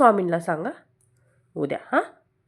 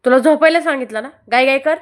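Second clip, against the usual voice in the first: loud scolding; 5 semitones higher, 8 dB louder, faster than usual. Real, not acted. Mother scolding child to sleep.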